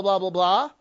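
ordinary talk, nothing marked

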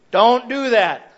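loud, slow stern warning; slow it down and bark the warning; parent's warning. Retold or imitated.

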